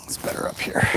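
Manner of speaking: soft unvoiced whisper to the horse. Real, not acted. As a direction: breathe it out, barely voiced.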